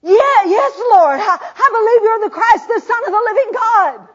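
high, loud, fervent confession; caught up in the spirit. Acted. Martha confessing to Jesus, high and fervent. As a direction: burst out in believing joy. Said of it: "she says".

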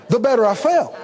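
ordinary talk, nothing marked

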